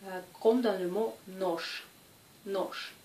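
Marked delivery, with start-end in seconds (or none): 1.3-1.8 "noche" ending in voiceless sh, like nosh; final sh sound. Shown as a demonstration. Teacher giving the example word, "noche" with hushed sh ending. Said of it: "se prononce ch". 2.5-2.9 "noche" as nosh, voiceless at the end; final sh sound. Shown as a demonstration. Teacher repeating the example, "noche" with voiceless sh ending. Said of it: "se prononce ch".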